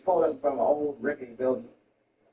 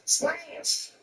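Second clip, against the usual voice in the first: usual speaking voice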